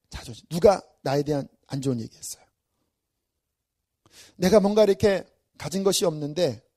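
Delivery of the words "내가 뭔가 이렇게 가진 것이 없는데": ordinary talk, nothing marked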